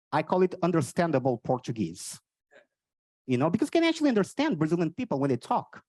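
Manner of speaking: ordinary talk, nothing marked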